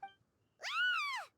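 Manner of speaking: sudden high yelp of fright; short high startled cry; startled shriek. Real, not acted.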